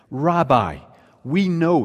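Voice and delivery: put-on playful half-done Scottish accent. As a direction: slip briefly into a Scottish accent and catch yourself. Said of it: "sorry, I won't do the Scottish accent".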